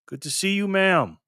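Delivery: usual speaking voice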